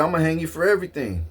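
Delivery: a hint of a heated threat; fast threat. Retold threat at the clerk.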